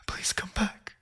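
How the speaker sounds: a high, unvoiced broken plea; high desperate whisper. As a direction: whisper it as your last hope. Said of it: "I whispered".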